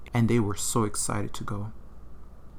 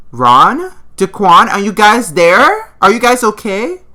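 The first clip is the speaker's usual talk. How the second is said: much higher, much louder calling out; yelling out; 11 semitones higher, 15 dB louder than usual. Acted. Susan yelling for Ron and Daquan through the house. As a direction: call out through the house in panic. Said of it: "Susan yelled out".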